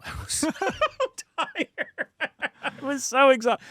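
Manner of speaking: laughing through the words; high-pitched, breaking up with laughter. Real, not acted. Laughs recalling dozing at the speech.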